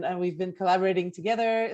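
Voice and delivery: ordinary talk, nothing marked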